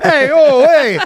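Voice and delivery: high, hammed-up New York accent; mock New York accent. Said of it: "New York".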